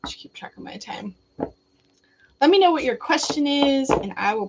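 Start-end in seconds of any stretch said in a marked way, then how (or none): 0.0-1.1 soft whisper to herself; whispered aside. Real, not acted. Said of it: "muttered aside to herself".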